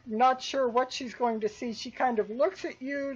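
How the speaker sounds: normal talking voice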